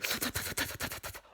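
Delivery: rapid hissing slurps; mock film-villain slurping noise; imitated slurp. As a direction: suck air in quick wet bursts. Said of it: "just throw quotes out here".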